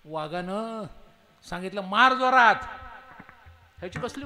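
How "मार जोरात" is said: very loud, high order; roaring command. Acted. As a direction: roar the command. Tiger demands a blow.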